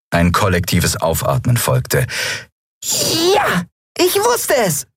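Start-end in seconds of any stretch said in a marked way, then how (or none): 2.8-4.9 very high, squealing little voice; jubilant cheer. Acted. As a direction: cheer as if dancing for joy. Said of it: "führte in der Luft einen Freudentanz auf".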